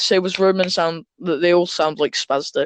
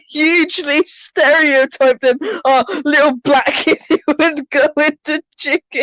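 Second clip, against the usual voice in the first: can barely talk for laughing; 8 semitones higher than usual. Real, not acted. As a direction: crack up while teasing a friend. Laughing at friend's description.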